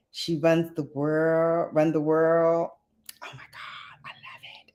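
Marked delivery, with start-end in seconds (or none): 3.2-4.7 very soft, voiceless whisper; gushing whisper. Real, not acted. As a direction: whisper it as if overcome with delight. Gushing over a favourite show.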